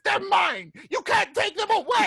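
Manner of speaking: very high, loud goblin shriek; squealing goblin tantrum; Bebo. Acted. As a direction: shriek possessively like a little goblin. Bebo shouts back, guarding buttons.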